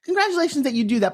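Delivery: normal talking voice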